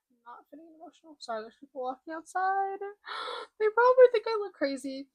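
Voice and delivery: high voice, close to tears; real choked-up struggle to stay composed; fighting back emotion. Real, not acted. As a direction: hold off crying, pitch climbing with a gasp.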